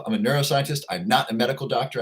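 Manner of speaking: ordinary talk, nothing marked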